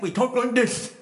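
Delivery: high mock lisp on "this"; imitated lisp. Friend joking about evolution to the preacher, lisping. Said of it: "I thought he was imitating me".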